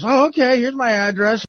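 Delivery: put-on very high, compliant girl voice; stripper caller. Girl giving the man her address, in a very high put-on voice. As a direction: jump up high for the naive girl. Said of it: "She's like".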